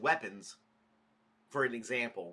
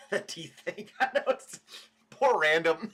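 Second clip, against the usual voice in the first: words through real laughter; giggling while talking; laughing; 10 semitones higher, 4 dB louder than usual. Real, not acted. Laughing at own gross video.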